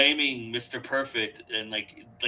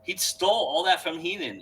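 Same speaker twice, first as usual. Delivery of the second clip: normal talking voice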